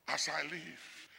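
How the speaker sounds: as I live dropped very soft and slow; God's oath repeated, hushed; soft echo. Acted. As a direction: echo as I live quietly under the breath.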